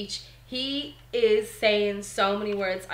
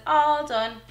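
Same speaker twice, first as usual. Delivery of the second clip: higher, louder toddler imitation; imitating toddler; 6 semitones higher, 5 dB louder than usual. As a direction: mimic the little one, pitched up and louder. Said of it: "He says".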